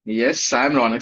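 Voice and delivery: ordinary delivery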